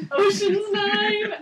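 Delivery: high, loud, drawn-out squeal; delighted exclamation. Real, not acted. Delighted reaction to the sea-creature pun.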